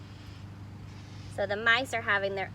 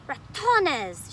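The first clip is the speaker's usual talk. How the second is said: cried; 6 semitones higher, 7 dB louder than usual. Acted. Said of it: "she cried".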